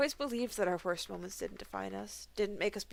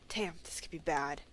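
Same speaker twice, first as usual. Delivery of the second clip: whisper; 4 semitones lower, 5 dB quieter than usual. Acted. Worried aside.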